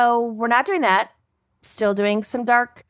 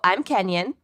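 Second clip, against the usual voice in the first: normal talking voice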